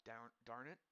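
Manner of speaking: soft, low mumble through a mouthful; mouth full of peanut butter. Real, not acted. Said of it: "I sound like I got peanut butter in my mouth".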